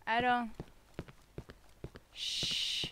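usual speaking voice

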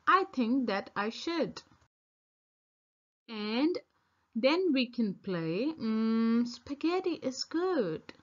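usual speaking voice

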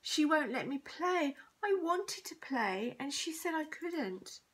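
high, drawn-out complaint; wailing. A narrator's reading. Bully tells on a girl to teacher, wailing. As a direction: wail it slowly like a wronged child. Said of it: "wailed Brendan the bully".

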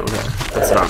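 ordinary talk, nothing marked

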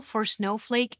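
ordinary talk

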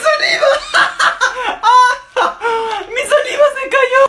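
shrieking with laughter; high laughing yell; laughing shout. Real, not acted. Saliva spills during challenge.

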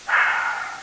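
long unvoiced strained exhale; a possessed voice sighing through a radio; deep rattling sigh. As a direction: let the breath scrape out. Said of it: "He sighed deeply".

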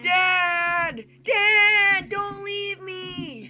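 hammed-up son crying out, high and drawn out; pleading son. Son calling after his dad in the game, high mock plea. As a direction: play a kid begging Dad.

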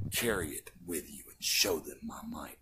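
low, hushed whisper of God; divine whisper. Acted. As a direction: breathe the command like a secret. God speaks to Samson.

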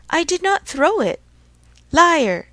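loud, high denial and accusation; heated quarrel. Acted. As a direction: hurl it back indignantly. Acted quarrel over thrown wood.

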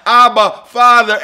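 loud cry of Abba, Father. Acted. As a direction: call out like a child to its dad. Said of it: "We cry".

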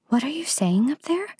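put-on high little girl's question; Gerda crying out. A narrator's reading. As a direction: call up to someone overhead as a child. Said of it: "cried Gerda".

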